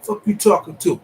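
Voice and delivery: loud, high, furious clapback; a hammed-up roommate blowup over the door; angry comeback. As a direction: bark it back at him like he insulted you. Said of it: "I'ma say".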